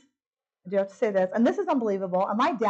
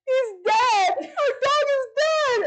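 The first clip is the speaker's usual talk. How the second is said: bawling; 4 dB louder, much slower than usual. Retold or imitated. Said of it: "And I'm just bawling".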